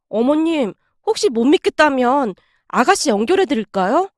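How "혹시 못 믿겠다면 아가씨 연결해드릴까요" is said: ordinary delivery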